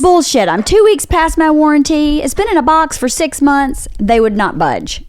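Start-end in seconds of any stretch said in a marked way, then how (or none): 0.0-3.9 indignant retort. Retold or imitated. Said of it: "And I was like".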